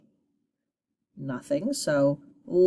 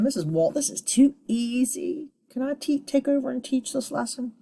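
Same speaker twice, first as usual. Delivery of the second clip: lightly voiced pupil, higher pitch; a hint of an eager student's higher voice; imagined pupil; 7 semitones higher than usual. Teacher voices pupils finding the lesson too easy, imagined pupil. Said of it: "Hopefully you're thinking".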